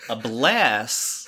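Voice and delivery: loud, condescending Southern blessing; veiled Southern insult. Shown as a demonstration. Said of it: "depends on how it's being said".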